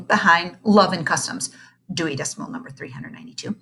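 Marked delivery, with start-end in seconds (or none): 1.9-3.6 fast whisper; whispered aside. A narrator's reading. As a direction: rattle it off in a whisper. Library call number aside.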